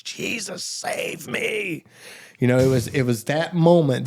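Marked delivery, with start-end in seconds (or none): 0.0-1.8 weak, hushed, strained plea; drawn-out, voiceless-edged plea; all he could muster. Retold or imitated. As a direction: imitate a desperate cry with barely any voice. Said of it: "all I could muster out of myself was".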